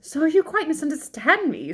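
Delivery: alarmed, high-pitched objection. A narrator's reading. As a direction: blurt it in alarm, pitched well up.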